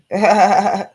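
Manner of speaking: loud burst of laughter. Real, not acted. Laughs after denying being a fan.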